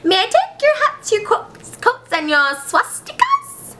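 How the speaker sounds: high, slowing, airy politeness; sassy assistant. Acted. Assistant taking the visitors' things, high and dainty. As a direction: ask daintily, drawing out the last word. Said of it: "he's a little sassy".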